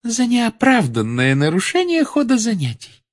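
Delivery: high, controlled teacher's answer; calm. A narrator's reading. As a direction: state it calmly in a high voice. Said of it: "спокойно произнесла профессор Амбридж". (спокойно, высоким голосом)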